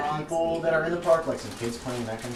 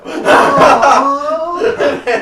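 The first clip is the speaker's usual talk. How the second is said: big shouted laugh; loud burst of laughter; 11 semitones higher, 11 dB louder than usual. Real, not acted. Big laugh at player's joke.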